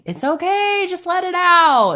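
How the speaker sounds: high, coaxing pep talk to herself; encouraging self-talk. Retold or imitated. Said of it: "I'm constantly telling myself".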